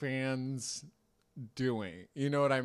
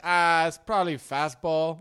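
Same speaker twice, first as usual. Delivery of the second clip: loud, higher impression of a cheery ballplayer interview; hammed-up, raised-voice player answer; Fernando Tatis; 5 semitones higher, 10 dB louder than usual. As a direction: imitate an upbeat athlete answering on mic. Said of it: "even when they interviewed him".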